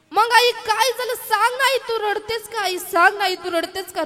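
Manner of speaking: loud, high, urgent begging; a child's loud, high insistent pleading. Acted. Child begging crying mother.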